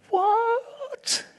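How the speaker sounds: sudden high cry of disbelief; shocked what. Retold or imitated. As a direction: leap up in outrage. Listener shocked at grace.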